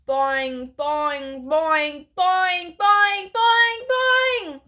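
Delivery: twangy boing, each one a step up; escalating frog boings. A narrator's reading. Frog's repeated hops and cries.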